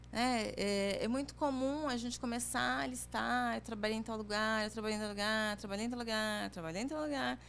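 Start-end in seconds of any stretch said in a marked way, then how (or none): 3.6-7.4 mock droning candidate, every item alike; monotonous listing. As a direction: rattle off a dull résumé on one level tone. Demonstrates dull listing of past jobs.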